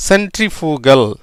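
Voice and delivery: சென்ட்ரிஃபுகல் said slowly and carefully; slow, measured சென்ட்ரிஃபுகல் for learners; slow model pronunciation. Shown as a demonstration. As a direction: spell out the sounds of சென்ட்ரிஃபுகல் unhurriedly. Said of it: "அப்படின்னு உச்சரிக்க வேண்டியது".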